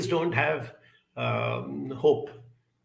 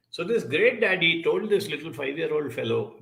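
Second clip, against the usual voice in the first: ordinary delivery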